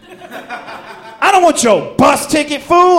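high, loud, drawn-out refusal; indignant acted shout. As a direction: yell the retort at full voice. Acted retort in a story.

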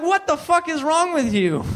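high-pitched, incredulous demand; high, indignant disbelief; mock exasperated outburst. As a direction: act out losing it at a roommate. Acts out outburst at roommate.

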